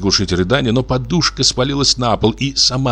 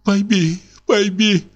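much higher, tearful plea; sobbing plea; 12 semitones higher, slower than usual. Acted. Wife begging husband to understand, much higher tearful plea. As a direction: start pleading through tears. Wife begins through sobs.

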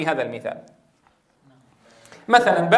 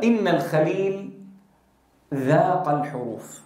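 quoting Sibawayh; 3 semitones lower than usual. Retold or imitated. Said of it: "سيبويه قال عن شيخه الخليل".

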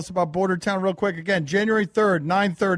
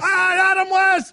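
high, loud, drawn-out shout; excited shout; 13 semitones higher, 7 dB louder, slower than usual. Real, not acted. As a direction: yell it with delight. Celebrating the Moist clip.

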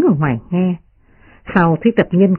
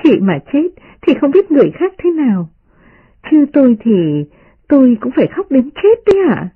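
much higher, playful mocking girl; teasing young lady; 8 semitones higher than usual. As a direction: tease them with a laughing lilt. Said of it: "Đại Ngọc cười nói".

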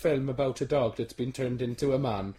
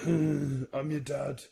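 Vader line; much slower than usual. Retold or imitated. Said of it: "That one".

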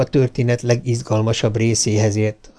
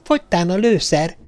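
high child voice, playing commander; a touch of pompous urgency; self-important; 8 semitones higher than usual. A narrator's reading. Little girl reporting to her pretend crew, self-important. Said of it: "fontoskodó hangon".

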